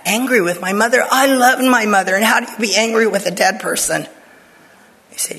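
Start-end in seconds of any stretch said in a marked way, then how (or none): 0.1-4.1 louder, higher, startled protest; retold disbelief at being called angry with her mother; shocked disbelief. Answering the Holy Spirit in shocked disbelief.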